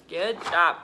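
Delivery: ordinary talk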